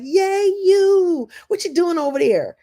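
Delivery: high, bright, eager cheer; cheering friend. Retold or imitated. Supportive friend cheering on a person, excited and high. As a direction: cheer them on like a delighted friend.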